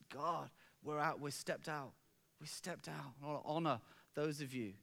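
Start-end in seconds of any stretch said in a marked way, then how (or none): 0.1-3.2 hushed prayer. Retold or imitated. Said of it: "Just said".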